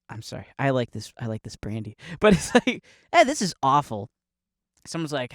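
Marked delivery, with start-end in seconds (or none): 3.1-4.1 mock offhand criticism; dismissive. Someone today judging brandy, lightly dismissive. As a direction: brush it off with mild disdain. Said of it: "to us now".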